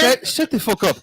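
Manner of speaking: squeaky mock-British telling off; a high, hammed-up Brit yelling at a mate; British cursing. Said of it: "Can you just do a line of cursing?".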